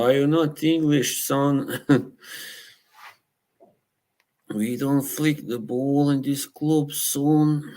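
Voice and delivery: hint of a teasing old English manager; Brian Clough impression. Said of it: "who does the best impression of Cloughy".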